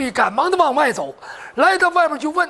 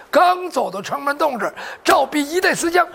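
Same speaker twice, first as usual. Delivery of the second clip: usual speaking voice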